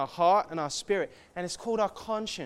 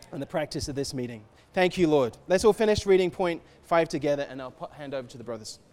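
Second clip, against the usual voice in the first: ordinary talk, nothing marked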